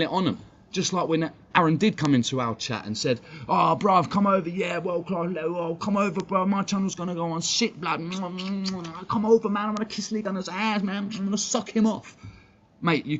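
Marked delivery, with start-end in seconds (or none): 3.5-12.2 mocking imitation. Mocking Aaron's words in chat.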